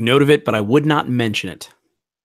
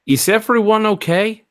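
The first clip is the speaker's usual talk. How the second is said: alarmed call; 11 semitones higher, 5 dB louder than usual. Acted. Character alarmed after scream.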